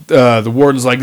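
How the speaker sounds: ordinary talk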